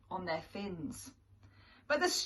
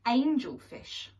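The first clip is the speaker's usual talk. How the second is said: ordinary delivery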